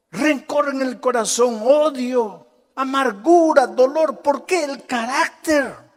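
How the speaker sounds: high, emphatic preacher's lament; impassioned preaching. Real, not acted. Preacher listing what bad character ruins, impassioned.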